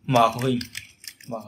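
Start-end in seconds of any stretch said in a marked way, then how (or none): none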